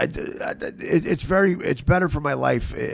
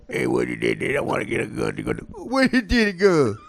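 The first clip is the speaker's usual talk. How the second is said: garbled Italian-American banter. Acted.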